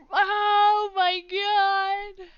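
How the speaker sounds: slow, loud, high despairing cry; drawn-out wail. Real, not acted. Streamer dreading a French accent, long wail. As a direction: stretch it out in mock agony.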